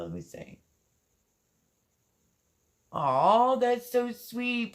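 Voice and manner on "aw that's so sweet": slow, higher and moved, close to crying; touched, near tears. Real, not acted. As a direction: let the sweetness choke you up a little. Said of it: "don't make me cry".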